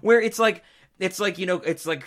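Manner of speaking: ordinary delivery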